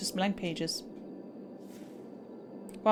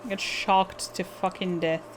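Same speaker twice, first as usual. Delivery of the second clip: normal talking voice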